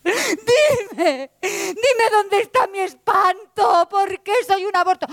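high, slow crying lament; sobbing despair. Acted. Truth begging the Lie to see her ugliness, high slow sobbing. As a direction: wail it through sobs. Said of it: "dijo la verdad".

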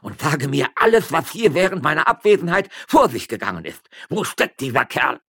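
high, loud furious yelling; angry shouting. Acted. Magistrate demanding the miller's whereabouts, shouting. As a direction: bellow threats at her. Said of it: "schrie der Stadtrichter".

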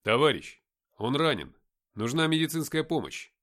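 loud, unhesitating answer; ответ без запинки. A narrator's reading. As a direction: answer the sergeant firmly and loudly. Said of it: "Без запинки ответил я". (громко, без запинки)

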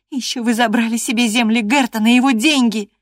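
high, loud obstinate accusation; reckless stubbornness. A narrator's reading. Girl keeps accusing the master, recklessly stubborn. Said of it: "с безрассудным упрямством продолжала она".

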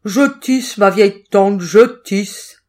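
put-on loud, gruff cat posing as the girl; big, forceful cat reply; cat's big voice. A narrator's reading. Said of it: "répondit le chat d'une grosse voix".

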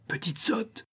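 sharp whispered scolding; tout bas. Acted. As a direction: hiss it under your breath at the child. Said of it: "lui dit tout bas Thalie". (reproche chuchoté tout bas)

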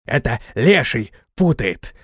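slow, clipped creature bark; slow barking hag's head; curt barking. A narrator's reading. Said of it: "отрывисто пролаяла голова".

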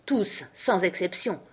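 "Tous, sans exception" slow and weighty; emphatic. A narrator's reading. King insisting on "Tous, sans exception", slow and emphatic. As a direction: lean on each word of "Tous, sans exception", with a break after "Tous". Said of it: "en appuyant sur ces mots".

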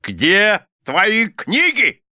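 high, slow, drawn-out question; abrupt demand. A narrator's reading. Husband suddenly demanding the books from wife, imperious. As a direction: demand it imperiously, stretching each word. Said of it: "вдруг спросил мистер Корнер".

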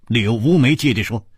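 plain reading voice

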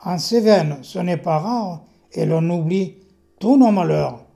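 slow, measured verse delivery with lifted emphasis; poem recitation. Acted. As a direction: recite your poem to the room, loud and deliberate. Recites own poem aloud. (récitation de poème, lente et bien scandée)

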